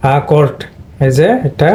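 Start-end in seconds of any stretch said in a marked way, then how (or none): 0.1-0.6 অ্যাকর্ড opened with an "a" (আ) sound; "আ" sound first. Shown as a demonstration. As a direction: say অ্যাকর্ড as "a-KORD". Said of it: "এটা মানে আ হবে".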